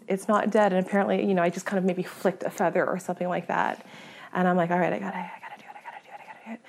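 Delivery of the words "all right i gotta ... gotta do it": rapid whispered self-psyching, trailing into breath; panicked self-talk. Retold or imitated. Hunter psyching herself up to shoot, frantic whisper. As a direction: mutter it fast under your breath. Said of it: "really slow my breath down".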